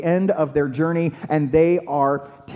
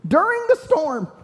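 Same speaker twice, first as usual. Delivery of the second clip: incredulous; 10 semitones higher than usual. Retold or imitated. Preacher telling how disciples find Jesus asleep in the storm, incredulous. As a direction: voice leaping up, aghast at what they find.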